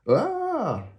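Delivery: very high falling cry; a comic wail while falling; miserable cry. A narrator's reading. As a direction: yelp as you drop through the floor. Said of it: "he cried miserably".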